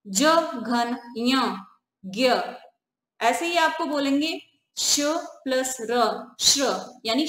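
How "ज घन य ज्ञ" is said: slow spelling out. Shown as a demonstration. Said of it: "spelling out the conjunct".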